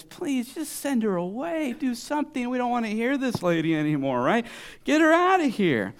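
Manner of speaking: high, whiny complaint; fed-up, hammed-up pleading; exasperated plea. Voicing annoyed plea about the woman.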